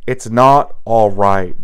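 slow, loud "not" in "It's not all right"; anguished. Acted. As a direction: push back, slow and loud. Distraught bodyguard answering Celia.

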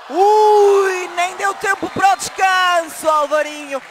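loud, high burst of amazement; explosive goal commentary. Real, not acted. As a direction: yell it, stunned by the quick goal. Commentator calls instant goal.

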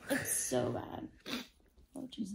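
breathy pained whisper. Real, not acted. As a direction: sore whisper. Groaning about sore body.

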